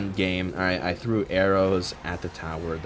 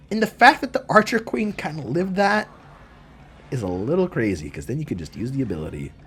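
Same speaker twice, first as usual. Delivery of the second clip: normal talking voice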